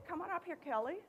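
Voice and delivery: normal talking voice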